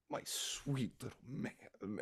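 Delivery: slow, soft, doting murmur; tender mother. Acted. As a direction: melt over your little child, softly. Mother moved by child's gift.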